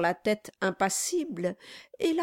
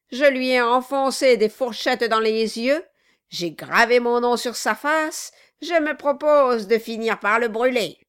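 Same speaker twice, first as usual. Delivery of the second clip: louder, higher bragging about cruelty; gleeful dwarf; 4 semitones higher, 7 dB louder than usual. Acted. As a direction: relish every cruel detail. Quilp boasts loudly.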